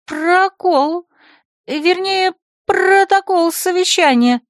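put-on high, stumbling girlish voice; stammering secretary. Flustered secretary answers the rector, high and stammering. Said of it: "заикаясь, ответила Лулу".

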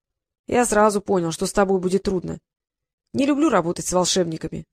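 ordinary delivery, nothing marked

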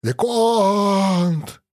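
hoarse cry through an earpiece; wheezing over headset. Acted. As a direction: croak it out over a bad radio. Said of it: "захрипел наушник".

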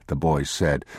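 ordinary delivery

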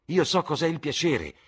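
high, loud youthful outburst; eager exclamation. A narrator's reading. Dorian breaks in on the lord about pleasure, exclaiming high and loud. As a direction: burst in with full conviction. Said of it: "esclamò Dorian Gray".

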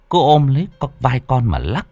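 ordinary delivery, nothing marked

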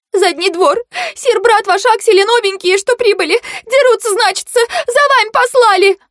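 very high, squeaky alarm; panicked maid. A narrator's reading. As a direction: blurt the news in piping panic. Panicked maid reports a fight. (испуганная служанка, тонко и высоко)

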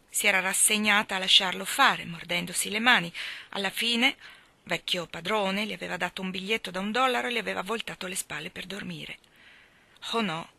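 usual speaking voice

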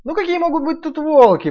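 high loud boasting piglet. Acted. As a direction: scoff high and cocky. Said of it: "проговорил в это время Ниф-Ниф".

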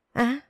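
sudden short scream of fright; startled yelp of terror; frightened scream. A narrator's reading. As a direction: cry out in shock at the snakes. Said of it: "la lớn vì hoảng sợ".

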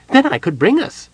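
high, loud excited Kender pitch. A narrator's reading. Tass excitedly hints he should go along. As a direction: bubble over hoping to come along. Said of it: "he added excitedly".

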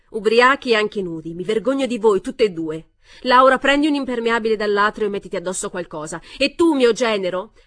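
loud, indignant shrieking scold. A narrator's reading. Mother scolding drunk naked daughter and son-in-law, loud shrieking. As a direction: scold them at the top of her voice. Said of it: "Edna stava strillando".

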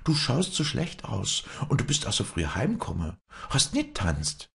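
put-on high-pitched old woman's voice, foreboding; worried old nurse. Old nurse reveals the cards to the girl, foreboding. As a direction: tell the omen in a high, anxious old voice.

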